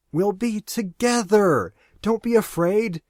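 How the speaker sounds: high and slow, dreamily soothing; entranced young woman. Acted. Lori soothes Arn, entranced.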